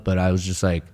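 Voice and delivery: usual speaking voice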